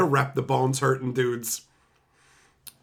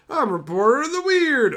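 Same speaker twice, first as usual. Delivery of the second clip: high, loud mocking announcement; mock announcer; 9 semitones higher, 5 dB louder than usual. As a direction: ham up the card's title. Mocking the card's title.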